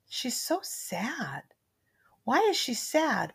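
put-on slow, soft little girl's voice; a hushed, slow toddler puzzling over a card; soft child voice. Toddler reading a tarot card to grandma, slow and soft.

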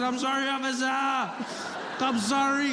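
high, wailing mock apology; panicked apology. Driver playing dead apologises to officer, panicked. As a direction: act out a panicking driver begging pardon. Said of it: "I completely panicked".

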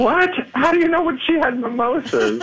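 high shocked what; stunned, voice jumping up with a laugh; shocked disbelief. Real, not acted. Shocked at the news.

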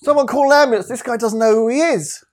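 high, mock alarmed call for help; mock panicked bystander. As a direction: shout it like a fake emergency. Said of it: "You say, like".

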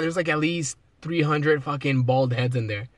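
ordinary talk

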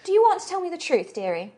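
high, quick start dropping on the last word; knowing prisoner. Acted. Halfling prisoner asking the guard for truth, knowingly coaxing. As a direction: coax it out in a character voice.

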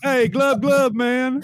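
high, loud fish gurgle; gurgling fish man croak. Pretend. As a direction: glub it out like a fish. Mocking friend's fish face.

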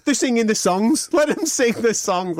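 high-pitched appeal to calm everyone down; mock pleading. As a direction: plead with the lads in a squeaky, laughing voice. Acts out placating his riled mates.

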